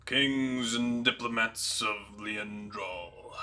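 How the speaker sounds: slow, deep rumbling king's welcome; deep gravelly giant king. Acted. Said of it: "the deepest, most gravelly voice you could picture".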